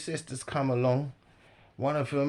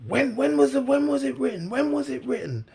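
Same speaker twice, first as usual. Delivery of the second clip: high, loud repeated demand; mock an insistent heckler, pitched way up; imitating heckler; 12 semitones higher, 7 dB louder than usual. Man cuts in demanding when it was written, high and loud.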